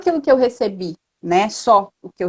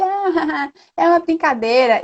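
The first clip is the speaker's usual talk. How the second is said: imitating a chuckling brush-off, pitched higher; dismissive little laugh; 6 semitones higher than usual. Vendor brushing off a customer's complaint, mock chuckle. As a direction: laugh it off lightly, mimicking the vendor. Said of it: "deu uma risadinha e disse".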